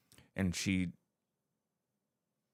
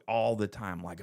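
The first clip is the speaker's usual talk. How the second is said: ordinary delivery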